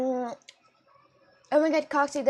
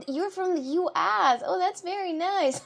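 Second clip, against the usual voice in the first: gushing reaction; 6 semitones higher than usual. Acted. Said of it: "I'm kind of like".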